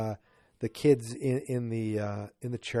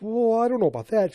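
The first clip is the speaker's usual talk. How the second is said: put-on higher, louder kid voices; kids' voices; 10 semitones higher, 6 dB louder, faster than usual. The kids doubting Alice, in a higher, louder voice. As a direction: pipe up like the Brady kids. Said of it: "and they're like".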